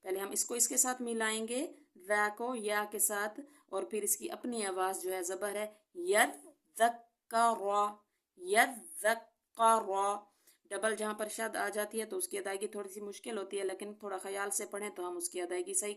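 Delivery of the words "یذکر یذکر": یذکر very slow, ذ and ک held doubled; double shadda. Shown as a demonstration. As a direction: press both shaddas in یذکر. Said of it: "یہاں پر دو دفعہ شد آئی ہوئی ہے". (یذکر دونوں شد کے ساتھ)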